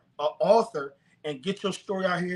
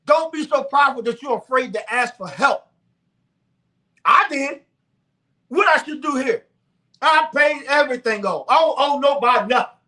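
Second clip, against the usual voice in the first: high, loud preacher's exhortation; preaching at full force; 7 semitones higher, 7 dB louder than usual. Real, not acted. Preaching to viewers about using God's gift of wealth, high and loud. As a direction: preach it out hard to the congregation.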